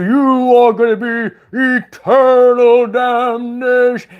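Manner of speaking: high, slow, wailing hellfire warning; hammed-up moaning preacher; mock doom preacher. Mocking preachers who threaten damnation, wailing. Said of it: "and they give you".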